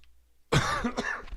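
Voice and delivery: harsh sick cough; coughing fit from a cold. Real, not acted. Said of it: "I'm coughing on stream".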